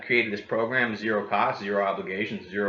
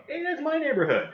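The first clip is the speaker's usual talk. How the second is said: much higher, delighted "hey"; delighted recognition; 14 semitones higher than usual. Retold or imitated. Neighbor sees own street online, pleased and higher. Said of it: "they go".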